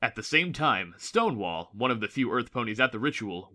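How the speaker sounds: plain reading voice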